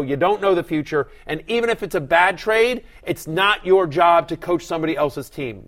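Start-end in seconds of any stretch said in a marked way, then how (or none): none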